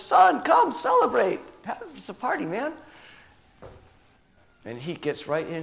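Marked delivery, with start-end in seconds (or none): 0.0-2.7 high, loud, hearty call to come celebrate; a father coaxing his son to the party; father's invitation. Acted. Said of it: "the dad comes out and says".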